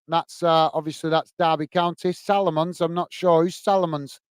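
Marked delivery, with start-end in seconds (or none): none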